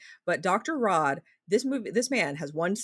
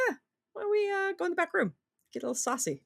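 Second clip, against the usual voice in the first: high mock-seductive come-on; hammed-up sleazy invitation to the back room; sleazy seducer; 13 semitones higher, faster than usual. Pet shop owner luring her, mock seductive voice.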